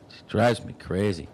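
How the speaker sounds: ordinary talk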